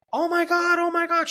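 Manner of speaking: imitating wife's high, loud panic; retold screaming. Wife screaming over her collapsed husband, imitated panicked scream. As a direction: mimic a frantic high-pitched scream. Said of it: "She's screaming, crying".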